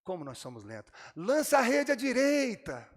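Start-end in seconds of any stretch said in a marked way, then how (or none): 1.2-2.9 a high, loud instruction shouted out; calling to the boat. Acted. Jesus shouts to the fishermen in the boat, loud high call. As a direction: throw the command across the water.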